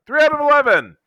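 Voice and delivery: very high-pitched, exuberant repeat; excited exclamation. Real, not acted. Fan celebrating his movie ranking, excited high exclamation. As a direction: crow the tally, pitch shooting right up.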